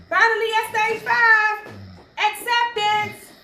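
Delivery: high, loud announcement of the final stage; loud, lifted poetic declaration; triumphant proclamation. Acted. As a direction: call it out, holding the last word long.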